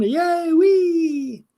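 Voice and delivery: put-on high, gleeful kid's cheer; childlike glee. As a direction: squeal it like a seven-year-old on a bike. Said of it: "Feeling like I'm seven years old".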